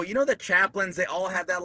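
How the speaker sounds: ordinary talk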